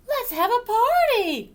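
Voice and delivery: high, loud party shout; excited cheer. Acted. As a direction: burst out with the happy idea. All cheer in the story.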